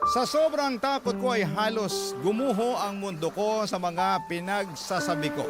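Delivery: ordinary talk, nothing marked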